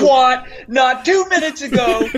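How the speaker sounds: high, laughing indignation; mock outrage. Husband complaining about unequal swearing rules, mock outrage.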